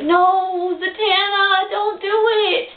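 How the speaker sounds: high wail of mock despair at the screen; pleading cry. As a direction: beg her not to put it on. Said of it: "I was just like".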